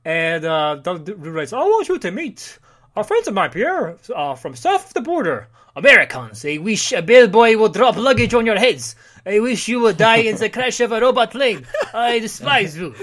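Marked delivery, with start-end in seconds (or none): 1.5-12.9 put-on ranting old villain. Old man villain speech.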